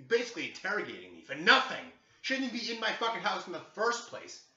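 loud, rising indignant outburst; fed-up, high-pitched protest; real aggravation. Real, not acted. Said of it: "I was more aggravated".

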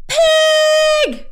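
high, very loud single yell; furious shout. A narrator's reading. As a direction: spit the word at the pig. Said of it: "shouted Eugenia".

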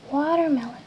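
"watermelon" with an English w and r; English word watermelon. Shown as a demonstration. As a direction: model "watermelon" clearly. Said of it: "se dice".

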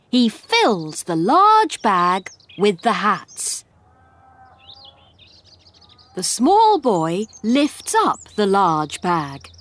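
usual speaking voice

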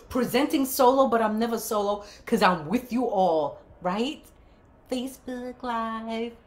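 announcer voice, up front; news anchor voice. Acted. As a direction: present it like a network anchor. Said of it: "my network anchor voice".

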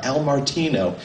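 normal talking voice

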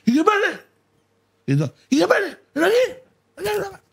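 high-pitched hammed-up yelling; mock shouting owner. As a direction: call across the terrace like a frantic owner. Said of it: "empieza a gritar como un energúmeno".

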